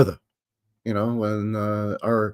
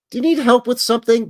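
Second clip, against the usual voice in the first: high, loud, falsely helpful question; a suspicious homeowner feigning helpfulness; mock sweet Canadian Karen asking, pitched up; polite Karen; 12 semitones higher, 7 dB louder than usual. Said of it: "a Karen".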